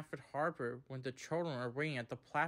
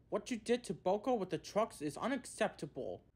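higher-pitched telling-off; firm; 6 semitones higher than usual. A narrator's reading. He reprimands Thomas and Percy about Boko, firmly. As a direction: lay down the law firmly. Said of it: "he said firmly".

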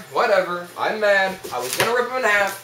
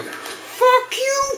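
very high, squealed curse; angry screech. Real, not acted. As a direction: screech it at the opponent. Yells insult at game opponent.

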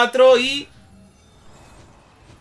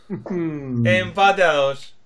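very slow, lower, stretched call; 7 semitones lower, much slower than usual. Acted. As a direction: hold the a very long. Drawn-out tie announcement.

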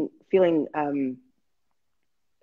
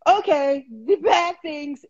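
higher, slow, strained voice; shaky high voice; 9 semitones higher, much slower than usual. Retold or imitated. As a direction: squeeze the words out high and slow. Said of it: "So I was like".

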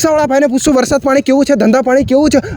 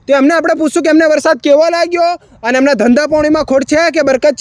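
bright, slightly higher host voice welcoming viewers; channel host intro; 5 semitones higher than usual. Acted. Reporter welcomes viewers before interviewing rickshaw driver, host voice. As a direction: sell it like a small-time YouTube reporter.

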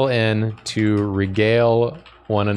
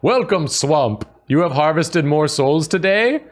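booming voice; 6 semitones higher than usual. Acted. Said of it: "booming voice reply".

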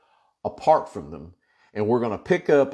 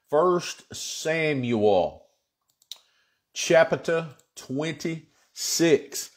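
word by word, with long pauses; slow announcement; 3 semitones higher, much slower than usual. Real, not acted. Pastor announcing the chapter to congregation, slow announcement. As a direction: stretch out each word of the reference.

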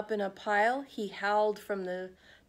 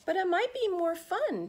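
high, hopeful little dog voice, much higher; pleading Trevor; 10 semitones higher than usual. Acted. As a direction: ask sweetly, pitched up like a small dog. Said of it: "Trevor said to Pig".

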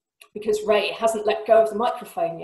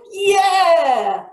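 loud, high roar; 10 semitones higher, 9 dB louder than usual. Acted. Singer roars to make the audience join in, loud roar. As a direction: roar it to get the crowd going. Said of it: "he roars".

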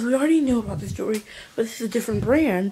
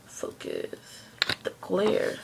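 hushed, voiceless little command under the breath; whispered aside; 3 semitones lower, 6 dB quieter, much slower than usual. Real, not acted. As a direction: whisper it to the camera. Muttering to the camera to focus.

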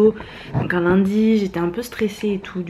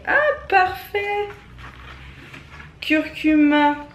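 high, slow delighted exclamation; thrilled by the surprise gift; 9 semitones higher, slower than usual. Real, not acted. Opening advent calendar box.

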